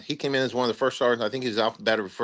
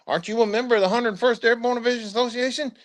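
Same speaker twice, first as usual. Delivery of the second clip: much higher, a put-on sly sergeant voice; imitating the first sergeant; 11 semitones higher, faster than usual. First sergeant quizzing a soldier about the association, high put-on voice. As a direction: play the crafty sergeant baiting a soldier.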